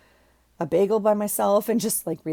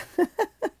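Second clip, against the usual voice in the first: high laugh; 14 semitones higher than usual. Real, not acted. Laughs at wipes packaging.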